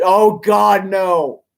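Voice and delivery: loud horrified oh God; dreading exclamation. Real, not acted. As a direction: cry out in real dread. Reader reacts in dread.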